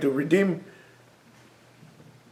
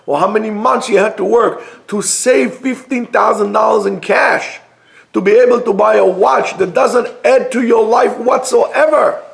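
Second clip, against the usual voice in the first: ordinary talk, nothing marked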